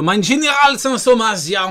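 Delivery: loud mock German officer accent; fake German accent. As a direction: bark it in a hammy German accent. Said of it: "je vais arrêter avec mon accent". (faux accent allemand appuyé)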